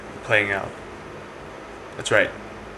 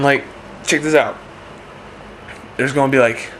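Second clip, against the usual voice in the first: ordinary delivery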